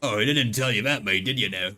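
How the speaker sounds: playful mock-Australian chat; amateur Australian impression; bad Australian accent. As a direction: put on a clumsy Australian accent. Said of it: "I can't actually do an Australian accent".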